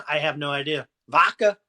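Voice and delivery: usual speaking voice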